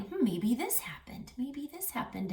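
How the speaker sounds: soft wondering whisper. Acted. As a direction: whisper guesses to yourself. Voicing animals guessing quietly.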